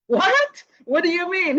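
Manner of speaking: retold gasp of disbelief; surprised disbelief. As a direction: jump up in pitch in astonishment. Disbelief at facilitator's reply.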